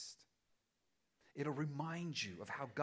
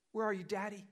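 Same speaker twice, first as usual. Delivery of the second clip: high, louder plea; child's cry; 8 semitones higher, 8 dB louder, faster than usual. Acted. Pastor voicing a lost child calling for Daddy, plaintive.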